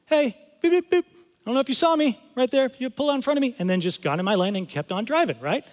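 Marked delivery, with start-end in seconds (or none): 0.1-1.0 high-pitched imitation car horn; light, high beeps like a polite toot; quick little honk. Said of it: "gave her a quick little". 1.0-3.5 high-pitched, harmless honk voice; high, mock-innocent explaining; polite little heads-up. Voicing the friendly honk's message.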